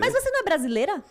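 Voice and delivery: mais agudo, alto e rápido, incrédulo; atendente incrédulo. Retold or imitated. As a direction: imita a surpresa do atendente. (atendente espantado questionando a brasileira)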